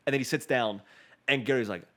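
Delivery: normal talking voice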